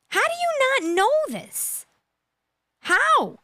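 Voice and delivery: high, slow, disbelieving; high-pitched exasperated how; incredulous. Real, not acted. Ranting disbelief at suspect.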